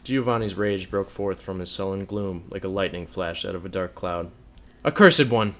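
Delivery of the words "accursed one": loud, scornful cry; furious cry of a betrayed lover; venomous scorn. A narrator's reading. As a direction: fling the curse at her in fury. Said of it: "cried he, with venomous scorn and anger".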